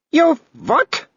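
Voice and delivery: very high sharp outrage; can't believe her ears; outraged Baroness. A narrator's reading. Baroness outraged at Tantony.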